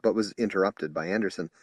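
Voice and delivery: usual speaking voice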